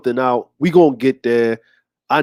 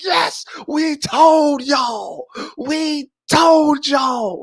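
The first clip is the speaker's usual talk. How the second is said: high, very slow triumphant shout; gloating shout; 10 semitones higher, much slower than usual. Retold or imitated. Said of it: "We're like".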